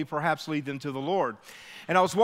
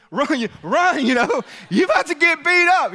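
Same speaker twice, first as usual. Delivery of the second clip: urgent yell; 9 semitones higher, 7 dB louder than usual. Retold or imitated. Urging the boy to flee.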